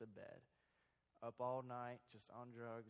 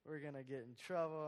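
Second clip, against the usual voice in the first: panicked; 3 semitones higher, 8 dB louder than usual. Retold or imitated. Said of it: "We're like".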